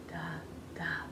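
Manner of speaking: duh, duh whispered very softly; tongue a soft whispered duh. Shown as a demonstration. Teacher leading the class in a whispered duh. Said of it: "I want you to say duh".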